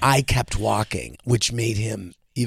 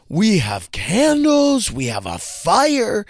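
put-on slow, much higher thrilled little kid; excited child; 8 semitones higher, 4 dB louder, slower than usual. Dad imitating his little son thrilled about candles and fire. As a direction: stretch out the wonder like a delighted toddler. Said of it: "He was so excited".

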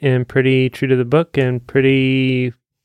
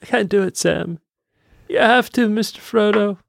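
higher, pleading hobbit voice; Sam and Frodo impression; 7 semitones higher than usual. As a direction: act out a mock-earnest hobbit plea. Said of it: "Much like Sam and Frodo".

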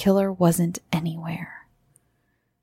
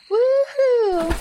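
very high, held woohoo at moderate volume; excited whoop. A narrator's reading. Girl jumping in for a swim, excited whoop. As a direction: let out a high mock scream of joy. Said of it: "Kingsley screamed".

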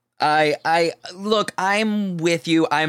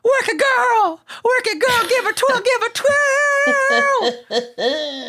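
high shrieking [name] cheer, much higher; crazy [name] impression; 3 dB louder than usual. Imitating a crazy [name] cheer, much higher and louder. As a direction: shriek it like a wild [name] drag act. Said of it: "we've seen the crazy gagas".

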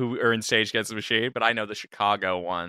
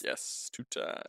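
whispered; 13 dB quieter, slower than usual. Acted. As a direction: breathe it smugly. Smug quiet reply.

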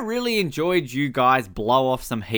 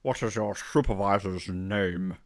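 low slow mock voice; 6 semitones lower, 5 dB quieter, slower than usual. Voicing film character.

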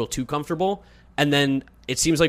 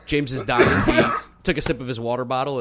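ordinary talk, nothing marked

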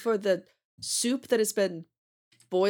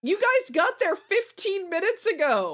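incredulous; 9 semitones higher, 3 dB louder than usual. Real, not acted. Incredulous protest, higher and louder.

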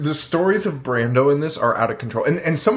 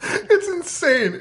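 drawn out slowly, pitched way up; stunned disbelief; much slower than usual. Real, not acted. To his co-host about Brando's stunt, stunned and drawn out. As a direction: let the disbelief stretch out high. Friends marvel at a wild story.

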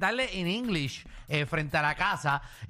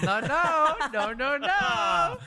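drawn-out high, louder nos; mock refusal; 7 semitones higher, 3 dB louder, much slower than usual. Host teases caller about going on vacation, drawn-out mock no. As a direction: stretch each no slowly, teasing.

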